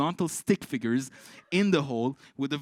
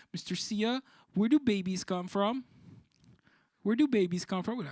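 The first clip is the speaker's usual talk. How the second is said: put-on child voice; 7 semitones higher than usual. Child student pestering teacher.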